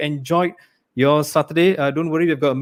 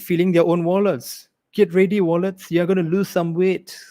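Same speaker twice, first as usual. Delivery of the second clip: normal talking voice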